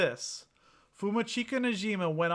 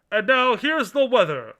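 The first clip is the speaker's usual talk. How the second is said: loud anchor handoff; news announcer voice; 8 semitones higher, 10 dB louder than usual. Pretend. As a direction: toss to the weather like a newscaster. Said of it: "announcer voice".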